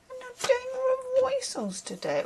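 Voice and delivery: very high, soft mock New York accent; hammed-up high New York accent; terrible New York accent. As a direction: squeal it softly like a badly faked New Yorker. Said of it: "That's my terrible New York accent".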